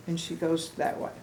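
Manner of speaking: ordinary delivery, nothing marked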